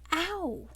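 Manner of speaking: high "ow" of pain; short high-pitched ouch; pained yelp. Acted. Heroine hit in the face by door, yelping.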